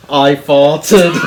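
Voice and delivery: loud mock-British confession; comic impression, announcing it frankly.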